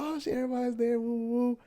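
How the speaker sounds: high-pitched mock cheering; high, playful crowd whoops; excited greeting. Acting out excited greetings at audition.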